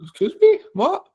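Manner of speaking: high, squeaky outraged surprise; mock disbelief. Retelling her shock at strong returns, high mock disbelief. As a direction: act stunned someone dared. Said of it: "And I was like".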